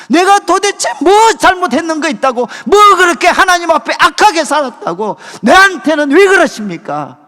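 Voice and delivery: indignant outcry; angry complaint. Retold or imitated. Voicing the congregants' angry complaint to God.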